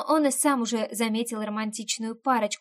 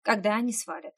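quiet growl. A narrator's reading. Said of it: "тихонько рыкнул Бастиан".

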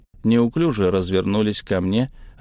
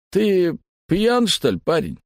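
mocking mercenary; 9 semitones higher than usual. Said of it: "спросил товарищ стрелка".

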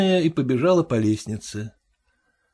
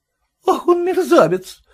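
indignant, rising outcry; indignant old woman's outburst; 11 semitones higher, 3 dB louder than usual. A narrator's reading. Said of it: "негодовала Анна Степановна".

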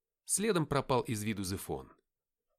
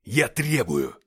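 loud, forceful bellow; roaring demand; 11 dB louder, much slower than usual. A narrator's reading. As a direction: thunder it out as a challenge. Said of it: "рявкнул Деймос".

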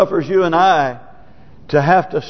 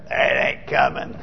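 scoffing; 5 semitones lower, 5 dB quieter than usual. Acted. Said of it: "And others saying".

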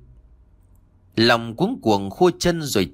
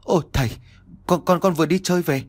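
stammering; 5 semitones higher, faster than usual. Acted. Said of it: "Long ấp úng".

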